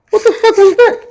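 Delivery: sudden high-pitched what-the-hell; startled outburst. Retold or imitated. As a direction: yelp it like something just flew past your head. Startled by orange thrown into cell.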